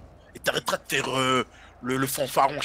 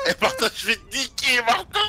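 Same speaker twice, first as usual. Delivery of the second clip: laughing; 6 semitones higher than usual. Real, not acted. Laughing mock threat.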